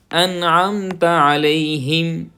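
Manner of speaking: انعمت علیہم with ع from the throat; clear ain. Shown as a demonstration. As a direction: stress the ع sounds. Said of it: "یہاں پر بھی عین کی آواز". (عین کی آواز پوری)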